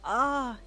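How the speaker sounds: loud startled gasp. A narrator's reading. Husband caught out by his wife, gasping. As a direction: gasp out in surprise. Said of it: "he gasped".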